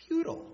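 Futile thrown out high; emphatic Futile. Acted. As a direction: punch the single word Futile. Preacher stresses key word.

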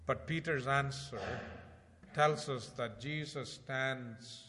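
urgent sermon delivery; fervent preaching. Acted. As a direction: preach it out to the congregation. Preaching at full force.

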